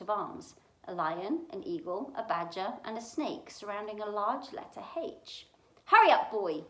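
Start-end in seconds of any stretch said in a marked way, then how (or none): 5.9-6.6 loud impatient call from another room; shouted. A narrator's reading. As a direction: bark it out to hurry the boy. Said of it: "shouted Uncle Vernon".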